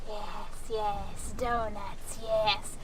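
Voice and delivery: soft, slinky tempter voice; quietly egging on from the shoulder; hushed, drawn-out urging; little shoulder devil. Acted. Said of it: "little Hamble on my shoulder going".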